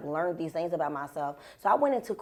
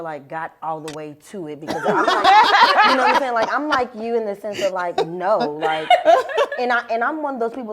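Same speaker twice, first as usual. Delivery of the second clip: laughing; 5 semitones higher, 9 dB louder than usual. Real, not acted. Laughing at touching hair.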